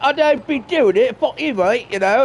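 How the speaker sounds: high, loud mock Yorkshire lad. Player mocking Wiggins with a hammed-up Yorkshire accent. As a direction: ham up a rough Yorkshire kid. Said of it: "that's totally Yorkshire".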